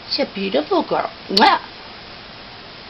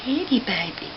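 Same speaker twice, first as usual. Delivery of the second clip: usual speaking voice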